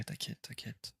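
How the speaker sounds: barely-there whisper; dropping into a whisper. Real, not acted. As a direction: let it fade to a breath. Fading reassurance to chat.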